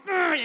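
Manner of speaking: drawn-out "grr" of frustration; frustrated growl. Acted. Comic on a word that gets it out of you, frustrated growl. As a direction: let the pent-up urge out as a growl. Acts out word forcing itself out.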